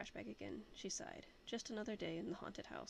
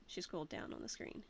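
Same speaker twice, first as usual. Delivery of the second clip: usual speaking voice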